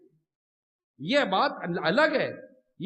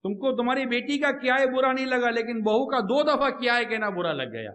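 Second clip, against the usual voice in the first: ordinary talk, nothing marked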